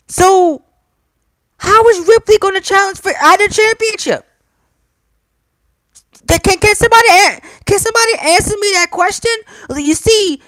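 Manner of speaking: high, fast, exasperated questions; exasperated rant. Real, not acted. Fan ranting at WWE booking.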